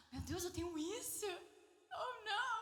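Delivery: high-pitched comic dismay with a gasp; overplayed horrified discovery; mock shocked realisation. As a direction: gasp it out, aghast and half laughing. Acts out sudden self-realisation.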